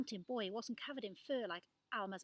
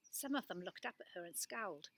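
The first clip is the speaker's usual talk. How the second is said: ordinary delivery, nothing marked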